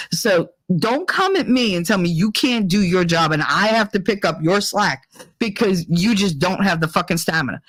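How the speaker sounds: ordinary talk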